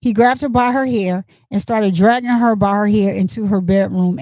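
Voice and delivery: ordinary talk, nothing marked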